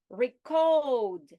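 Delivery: CODE in "Recode" loud and drawn out; stress on second syllable. Shown as a demonstration. Teacher showing verb stress, CODE stressed in "Recode". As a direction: hit the end syllable of "Recode" hard. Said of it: "stress on the second syllable".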